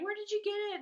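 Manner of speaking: high, loud excited question; imitated eager curiosity. Coworker asking mom about necklace, imitated eagerness.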